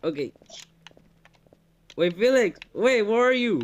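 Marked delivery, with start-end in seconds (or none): 2.0-3.6 louder, higher anxious call; scared calling out. Real, not acted. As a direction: call out for your friend, nervous. Lost alone in dark.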